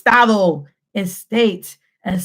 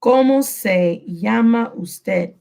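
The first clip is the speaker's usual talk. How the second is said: slow dictation; slower than usual. Shown as a demonstration. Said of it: "I want you to write".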